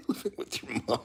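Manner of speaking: quiet laughing repeat. Real, not acted. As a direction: chuckle through the words. Rereading chat comment, laughing.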